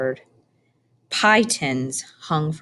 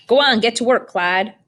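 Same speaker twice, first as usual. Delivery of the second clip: put-on louder, higher old woman barking an order; bossy order; 5 semitones higher, 5 dB louder than usual. A narrator's reading. Old woman orders the rabbit to scare birds, loud and bossy. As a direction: shoo him to work like a farmhand.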